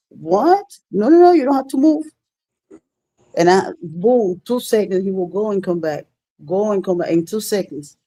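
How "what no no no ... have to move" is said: high, loud, quick protest; eager mock protest. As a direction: jump in with an insistent, high-pitched refusal. Acting out eager guy's reply.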